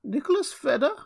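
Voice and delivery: put-on very high, reedy old voice, questioning; thin, piping old man. A narrator's reading. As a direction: pipe it up like a puzzled old man. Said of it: "an old man replied in a thin, piping voice".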